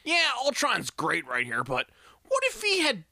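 mock studio note, rising high and slow on the pecs; studio exec voice. Reviewer mocking a studio note, hammed-up exec voice. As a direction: play a clueless exec pitching an idea. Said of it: "was a studio note".

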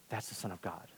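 very low, soft, solemn quote; quietly awestruck centurion; hushed realization. Acted. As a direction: let it fall away to a near-murmur.

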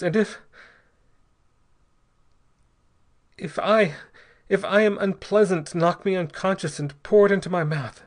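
high, halting desperate instructions; gasping. A narrator's reading. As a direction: force it out in broken, urgent bursts. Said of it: "gasped Ortiz".

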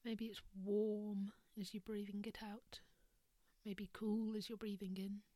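soft whispered meditation voice; guided meditation. Acted. Leads a breathing meditation.

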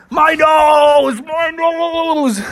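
high, drawn-out mock wail. Bubby rolls on the ground, wailing. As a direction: howl it long in cartoon agony.

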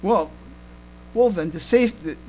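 ordinary delivery

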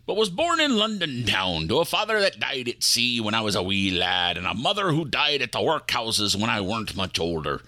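put-on a light pirate accent spinning a yarn. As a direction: tell the passenger his life story in a pirate accent. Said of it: "that's an odd accent".

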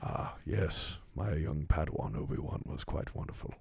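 a hint of a wise old mentor; Qui-Gon Jinn voice. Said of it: "Qui-Gon Jinn, man".

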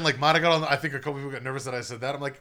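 ordinary talk, nothing marked

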